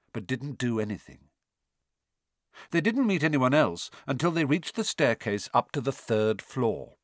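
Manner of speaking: ordinary delivery, nothing marked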